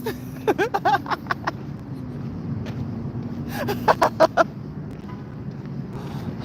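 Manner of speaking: long, soft wheezing laugh dying away; helpless laughing. Real, not acted. Streamer worn out by chat's jokes, wheezing with laughter. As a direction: laugh till you're worn out. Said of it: "Y'all got me hot from laughing".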